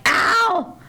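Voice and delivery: high, exasperated name call; imitated scolding; mock exasperation. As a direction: cut off a friend in retold annoyance. Retold exasperated interruption of friend.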